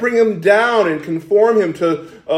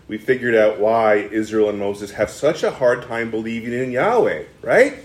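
usual speaking voice

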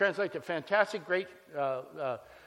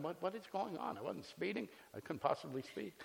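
softer, inward panicked thoughts; inner thoughts; 11 dB quieter than usual. Retold or imitated. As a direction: think it to yourself. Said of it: "I'm thinking".

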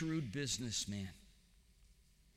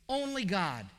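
normal talking voice

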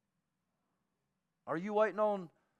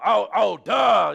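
mock Peter; 4 semitones higher, 15 dB louder, slower than usual. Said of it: "He's like".

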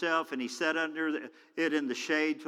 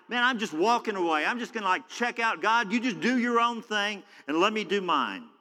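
raised-pitch, fed-up dismissal; frustrated with God; 6 semitones higher, 3 dB louder than usual. Acted. A disappointed believer walks away from God, acted frustration. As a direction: wave God off in acted exasperation. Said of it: "angry and disappointed and frustrated and mad at God".

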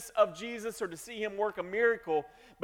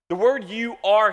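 "you are" said louder and firm; emphasis on you are; 2 semitones higher, 7 dB louder than usual. Shown as a demonstration. Pastor stressing the Greek to his congregation, emphatic. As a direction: lean hard on "you are". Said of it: "here in the Greek is emphatic".

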